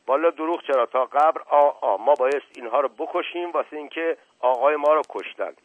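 loud voice. A narrator's reading. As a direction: declare it loudly to everyone. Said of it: "با صدای بلند گفت".

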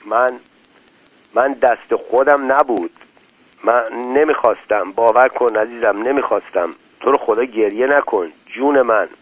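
ordinary delivery

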